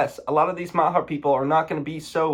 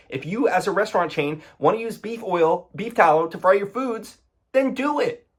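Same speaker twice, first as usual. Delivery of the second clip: high, exasperated ranting; real heated rant; emotional rant; 7 semitones higher than usual. Real, not acted. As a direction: vent the frustration, incredulous. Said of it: "I'm getting a little emotional".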